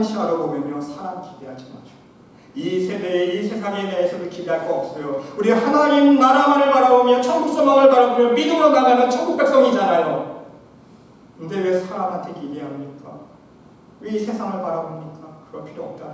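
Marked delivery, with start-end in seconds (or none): 5.4-10.4 loud, high ringing preacher's cry; sermon climax, voice raised high and loud; full-force preaching. Acted. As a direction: lift the congregation toward heaven.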